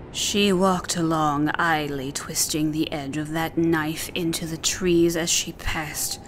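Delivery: plain reading voice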